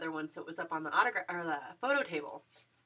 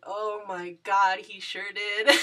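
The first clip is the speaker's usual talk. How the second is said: louder, laughing through the words; giggly agreement; 8 dB louder, slower than usual. Retold or imitated. Fan answering the little girl, giggling happily. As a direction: gush and giggle.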